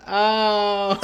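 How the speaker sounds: loud, drawn-out badly acted ow; mock a terribly faked cry of pain; shitty ow. Podcaster imitating an actor hit by a thrown phone, hammy ow. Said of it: "It's such a shitty ow".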